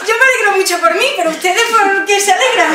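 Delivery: high, loud, laughing bewilderment; acted giddy excitement. New mother to three women hugging and jumping, acted giddy excitement. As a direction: exclaim it giddily through laughter.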